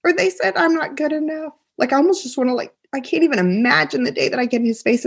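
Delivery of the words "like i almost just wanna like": ordinary delivery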